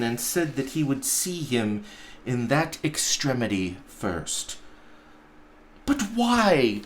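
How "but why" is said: higher, crying out in protest; the nephew cries out at his uncle, higher; cried out. Acted. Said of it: "cried Scrooge's nephew".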